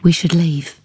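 usual speaking voice

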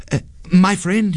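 high-pitched, hesitant appeal; quick-thinking young man buttering up a foe; placating hero. A narrator's reading. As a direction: win over a ghost in a high voice. Sanjay thinking quickly, placates ghost.

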